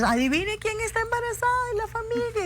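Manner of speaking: high, excited guessing-game tease; telling the family to guess who is pregnant, playfully high; playful announcement. Retold or imitated. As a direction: announce it like a fun riddle.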